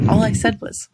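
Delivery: normal talking voice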